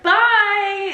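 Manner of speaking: high, loud retold farewell; mock cheery goodbye. Woman escapes a chatty stranger, hammed-up cheery goodbye. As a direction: call out an exaggerated bye.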